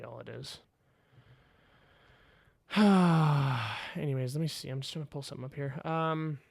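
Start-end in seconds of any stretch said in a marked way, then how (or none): 2.7-3.9 long, falling exhale; sigh. Real, not acted. After admitting he gives too much, a long sigh. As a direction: let out a long, voiced sigh.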